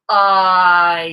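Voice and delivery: "I" drawn out slowly into ah and e; slow-motion diphthong. Shown as a demonstration. To singing students, showing the diphthong in slow motion. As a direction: stretch "I" so both vowel parts are heard. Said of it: "say it sort of like in slow motion".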